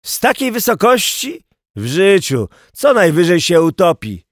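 high, scoffing question, then firm dismissal; lightly voiced know-it-all bystander; persuading. A narrator's reading. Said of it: "przekonywał niski kominiarz w cylindrze".

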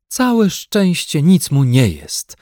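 slow relieved exclaim. A narrator's reading. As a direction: sigh it out in relief. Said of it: "wykrzyknęła z ulgą". (z ulgą)

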